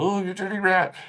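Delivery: high-pitched mock gangster impression; Jimmy Cagney. Film critic showing how the actor sounded, hammy gangster impression. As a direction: do an old movie tough guy, pitched well up. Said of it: "This is how Colin Farrell sounded".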